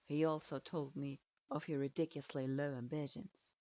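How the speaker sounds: hard, clipped authority of a teacher; lightly stern sensei. Acted. Sensei pins her with gaze.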